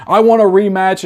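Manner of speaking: a high, pushy Apollo demand; mock Apollo pleading for a rematch.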